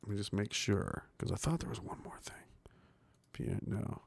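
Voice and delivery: fast whisper to himself; quick whisper. Real, not acted. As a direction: rush it under your breath. Muttering while checking notes.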